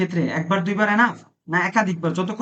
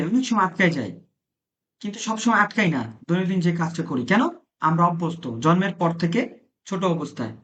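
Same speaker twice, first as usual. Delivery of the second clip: ordinary delivery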